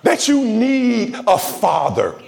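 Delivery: high, stirring sermon cadence; preaching. Acted. Preacher urging the church to see suffering's purpose, fervent. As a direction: lift the congregation with rising preaching.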